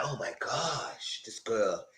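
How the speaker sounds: drawn-out, hushed exasperation; imitated weary muttering; over it. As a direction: mimic someone fed up, slow and soft. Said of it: "Hannah was over it".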